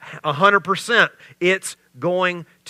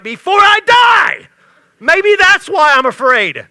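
loud, high sarcastic rant building to a shout; mock Jesus with biting sarcasm, yelling at the end; sarcastic Peter; 8 semitones higher, 10 dB louder than usual. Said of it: "my spiritual gift of sarcasm".

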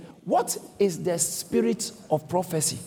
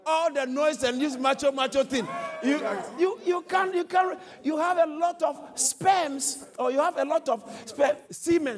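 shouted preaching; 7 semitones higher than usual. Real, not acted. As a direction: scoff at the macho boast. Preacher mocking macho boasting.

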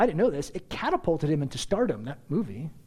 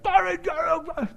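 high-pitched, drawn-out garbled yell; mock yelling; slower than usual. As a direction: imitate a driver yelling nonsense. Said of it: "He starts yelling at me".

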